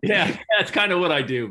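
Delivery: said through a high laugh; chuckling, amused and loud. Real, not acted. Joking about own messaging skill.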